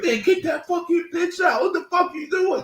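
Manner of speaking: high mock yell of the outraged crowd; friends yelling. The party guests ordering the girl out, a high yell. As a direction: shout it out like the whole party is fed up. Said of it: "everyone that saw that dude like".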